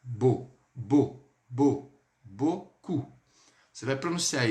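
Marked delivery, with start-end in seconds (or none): 0.0-2.0 "beau" repeated, said just as bo; drilled beau. Shown as a demonstration. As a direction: hammer out "beau" as a plain o. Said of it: "Vou explicar como que é a pronúncia". ("beau" repetido, som de ô)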